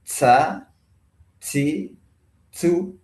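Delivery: SA, SI, SU begun with a hissy ts; overdone breath release. Shown as a demonstration. Teacher showing what to avoid, over-aspirated ts. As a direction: exaggerate the puff of air into SA, SI, SU. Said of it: "maka penyebutannya jangan".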